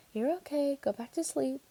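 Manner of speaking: high, soft sing-song hush; mother soothing her baby in a high lilt. A narrator's reading. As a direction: lull a fussing baby in a lilting voice. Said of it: "in a sing-song voice".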